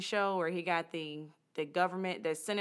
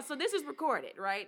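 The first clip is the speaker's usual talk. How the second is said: normal talking voice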